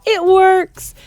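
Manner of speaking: cheering crowd. A narrator's reading. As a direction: cheer the leader wildly. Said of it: "yelled the audience".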